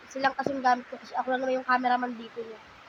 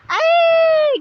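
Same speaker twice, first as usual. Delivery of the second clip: delighted squeal; 12 dB louder than usual. Real, not acted. Mother squealing at her smiling baby. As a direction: squeal with joy, much higher.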